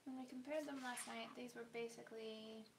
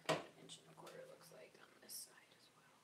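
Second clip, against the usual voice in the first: breathy unvoiced whisper; 5 dB quieter, faster than usual. Real, not acted. Sewing alone at night, whispering the measurement. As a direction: whisper it so the sleeping partner stays asleep.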